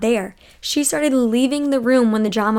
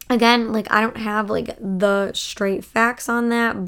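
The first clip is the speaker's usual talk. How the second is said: normal talking voice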